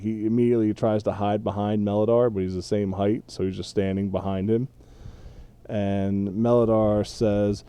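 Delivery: ordinary talk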